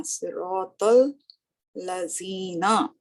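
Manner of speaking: सिरातल लजीना stretched out word by word; teacher modelling सिरातल लजीना; very slow recitation. Shown as a demonstration. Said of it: "same वही है".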